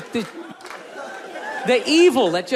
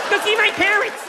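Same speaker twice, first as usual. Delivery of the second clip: high put-on girlfriend voice; lightly mocking girlfriend request; mock girlfriend demand; 9 semitones higher than usual. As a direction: squeak out her demand.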